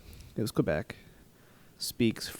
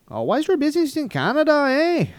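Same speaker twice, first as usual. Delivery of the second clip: high, loud mock Canadian accent; hammed-up Canadian border officer; 9 dB louder than usual. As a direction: squeal it in a cartoon Canadian accent. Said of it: "that Canadian accent".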